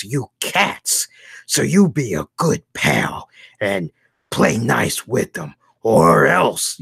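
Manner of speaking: slow, tough cartoon bulldog voice; Spike the bulldog. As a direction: lean in like a protective cartoon dog warning a cat. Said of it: "about Spike and Tyke".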